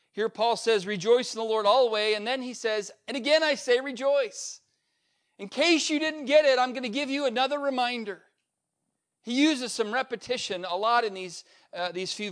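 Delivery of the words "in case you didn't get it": ordinary talk, nothing marked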